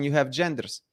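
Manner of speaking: normal talking voice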